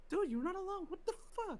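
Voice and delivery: high, startled "dude, what the fuck"; shocked outburst. Real, not acted. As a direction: jump way up in pitch, caught off guard. Outraged at chat.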